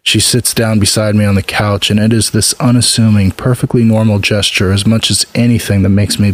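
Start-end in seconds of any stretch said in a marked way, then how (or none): none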